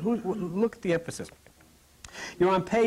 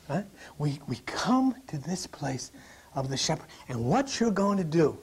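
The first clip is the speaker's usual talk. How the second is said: ordinary talk, nothing marked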